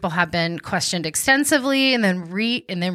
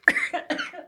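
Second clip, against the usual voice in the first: high strained choking cough; coughing fit; 5 dB quieter than usual. Real, not acted. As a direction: cough hard as water goes down wrong. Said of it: "I'm choking on water".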